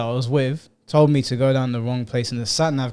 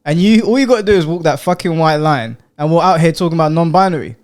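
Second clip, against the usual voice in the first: louder, higher mock rant; mock annoyed cop; 4 semitones higher, 7 dB louder than usual. Host pretends to be the officer ranting at the driver, mock fury.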